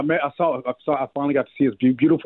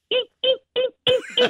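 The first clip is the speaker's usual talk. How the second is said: tiny, very high squeaky eeps; dying card squeaks. Retold or imitated. Imitating the card's last weak meows after two days, very high squeaks. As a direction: squeak like a battery-dying toy. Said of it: "It's like".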